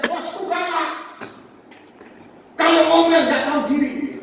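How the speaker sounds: acted complaint. Worker griping to preacher about a fierce boss, acted complaint. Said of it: "Dia bilang".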